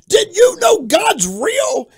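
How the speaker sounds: drawn-out, high-pitched holler; retold yell. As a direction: yell it slowly at a startled householder. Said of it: "I would yell out".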